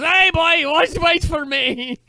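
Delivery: very high, loud, drawn-out Scottish holler; uncle calling out. Acted. Uncle chasing after the boy, eager high shout. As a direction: yell after the boy in a hammy Scottish accent. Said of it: "and the uncle goes".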